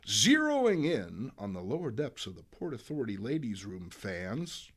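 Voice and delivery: usual speaking voice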